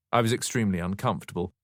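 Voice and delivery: plain reading voice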